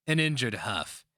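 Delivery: plain reading voice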